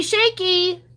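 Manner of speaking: shaky squealed high and bright; excited squeal. Shown as a demonstration. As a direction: squeal the word shaky with glee. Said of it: "really incredible shaky, shaky bonus".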